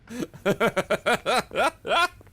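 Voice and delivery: high loud burst of laughter. Real, not acted.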